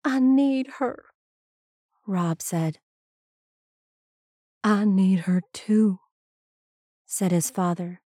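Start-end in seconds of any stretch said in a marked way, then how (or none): 0.0-1.1 put-on high, plaintive boy's confession; grieving son. A narrator's reading. Grieving son confides in father. 4.6-6.0 slow, understated reply in a father's voice; grieving father. A narrator's reading. Father answers grieving son.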